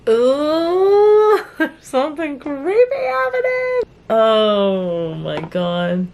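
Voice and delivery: high, loud, drawn-out ooh of delight; thrilled ooh. Real, not acted. Reader thrilled by a creepy scene, high squealing ooh.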